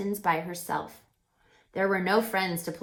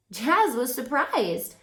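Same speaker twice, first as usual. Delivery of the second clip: ordinary delivery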